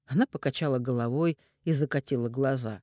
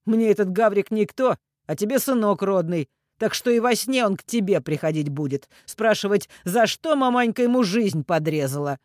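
louder, higher goading; teasing; 6 semitones higher, 7 dB louder than usual. A narrator's reading. Man needles the mother about her son, taunting. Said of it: "дразня Ирину".

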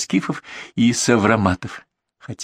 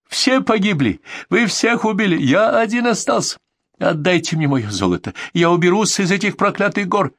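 high-pitched desperate raving; hysterical; 10 semitones higher than usual. A narrator's reading. Cornered bandit accuses them and begs for gold, hysterical and high. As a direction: wail the accusation with pitch far up. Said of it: "в истерике кричал Кацо".

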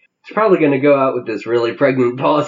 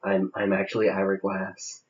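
softer, lower public radio host voice; Ira Glass; 5 semitones lower, 6 dB quieter than usual. Acted. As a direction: ease into a hushed radio host murmur. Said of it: "that's a pretty good Ira Glass".

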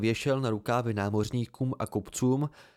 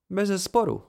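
a stiff, prim little yes, clearly higher; prim agreement; 8 semitones higher, 4 dB louder than usual. A narrator's reading. Girl Aury agreeing primly that Kvothe is kinder, prim and stiff. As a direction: agree primly with mock formality. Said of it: "přisvědčila upjatě".

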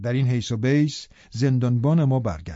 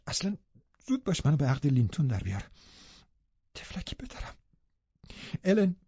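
softer, tearful pleading, breaking to a whisper; bitter crying; 2 semitones higher, 7 dB quieter than usual. Acted. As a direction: plead through tears, barely holding on.

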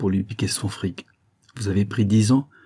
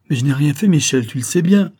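higher and louder, pleading his innocence; desperate protest; 5 semitones higher, 6 dB louder than usual. Acted. Caneparo begging Michel to believe him, panicked and loud. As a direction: insist in a rising panic that you did nothing.

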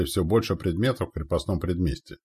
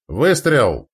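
loud, high firing call; sharp rise in a gunner's shout; shouted command; 6 semitones higher, 8 dB louder than usual. A narrator's reading. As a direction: cry out the order as the gun fires. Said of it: "выкрикнул Анцифор".

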